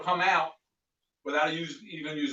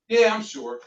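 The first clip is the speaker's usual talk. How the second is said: firm customer refusal; 6 semitones higher, 5 dB louder, slower than usual. Acted. Role-play customer refusing.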